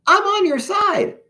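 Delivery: very high-pitched pleading cry; shouted plea. A narrator's reading. Germ tells his attackers he is an ally, shouting. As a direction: plead shrilly in a little germ's voice. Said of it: "he shouted".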